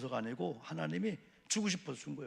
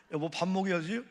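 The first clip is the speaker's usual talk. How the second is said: husband's plea; 5 semitones higher, 7 dB louder than usual. Retold or imitated. Husband with burned tongue at home.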